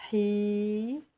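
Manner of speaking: "hi" with the EE drawn out; long vowel. Shown as a demonstration. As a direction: stretch the I of "hi", rising at the end. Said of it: "la prolongation avec le ya".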